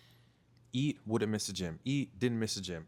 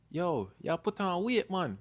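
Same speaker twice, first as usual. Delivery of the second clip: higher-pitched imitation of a Jamaican mom; Jamaican accent; 8 semitones higher, 4 dB louder than usual. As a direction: quote mom in a lifted Jamaican lilt. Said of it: "she's Jamaican, I'm Jamaican".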